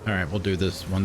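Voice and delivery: normal talking voice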